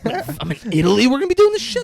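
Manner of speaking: laughing high-pitched glee; hyped-up crook. Retold or imitated. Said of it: "He's like".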